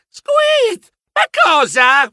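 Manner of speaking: very high squeak then loud cry; mousy squeak of panic; panicked squeak. Acted. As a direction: squeak in alarm. Panicked narrator, unsure what to do.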